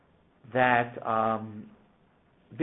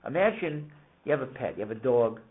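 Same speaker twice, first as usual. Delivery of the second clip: usual speaking voice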